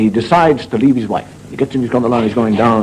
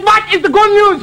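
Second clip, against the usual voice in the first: put-on German accent; 3 dB louder than usual. German patient agreeing to lose his leg, comic accent. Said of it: "He said".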